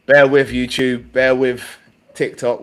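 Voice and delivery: loud, slow address to the audience; calling out to viewers. Real, not acted. Host asking livestream viewers to hold on, loud and drawn out. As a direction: raise your voice, stretched and deliberate.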